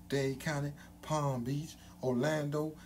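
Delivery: normal talking voice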